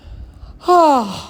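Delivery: sigh. Acted. Sad story moment, sigh.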